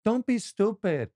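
high, drawn-out mock female scolding; imitating women. Comic imitates women telling men off, high mock scolding. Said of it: "Women be like".

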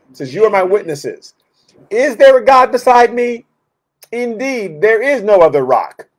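fiery preaching. Acted. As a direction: proclaim it with force from the pulpit. Preaching scripture at full force.

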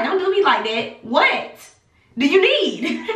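mostly near her normal voice, a laugh at the end; lightly exasperated retelling; mock exasperated. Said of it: "I'm like".

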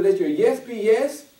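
slow, weighty scripture quote; preacher reading scripture, slow and loud. Acted. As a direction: lay each word down slowly. Quoting the Bible.

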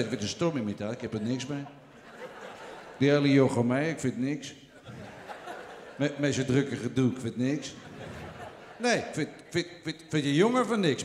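lage, trage stem van een oudere komiek; Toon Hermans imitatie. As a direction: zak diep en traag weg als een oude cabaretier. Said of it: "ik denk ongeveer zoiets als dit". (trage, lage imitatie van een oude komiek)

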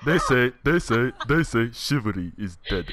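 put-on loud accented proclamation, repeating the opening; Doomfist impression. Proving his voice to a doubting player, loud Doomfist impression. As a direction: proclaim it in the heavy hero accent. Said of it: "Okay, now that's good".

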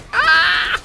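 high, loud scream; startled scream. Real, not acted. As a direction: shriek in sudden alarm. Scream in the game. (hoher, lauter Schrei)

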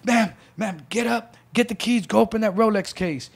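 commanding gunman. Retold or imitated. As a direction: tell her to move, no nonsense. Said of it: "He's just like".

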